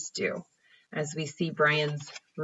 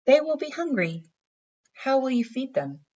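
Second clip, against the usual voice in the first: voicing the mother; 9 semitones higher, 3 dB louder than usual. Mother questions boy in story.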